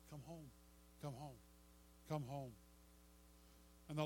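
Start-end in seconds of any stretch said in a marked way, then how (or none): 0.1-2.5 tender invitation. Acted. Father calling prodigal home.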